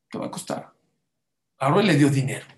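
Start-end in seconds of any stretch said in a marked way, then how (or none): 0.1-0.7 the repeat breathed out in a whisper; ominous whispered warning. Acted. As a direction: drop to a knowing whisper.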